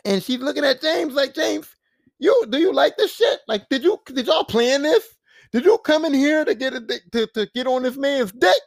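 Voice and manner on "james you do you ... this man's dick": high-pitched, incredulous questioning; accusing confrontation. Retold or imitated. Alyssa confronting James about the bathroom, accusing. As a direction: demand answers in mock outrage.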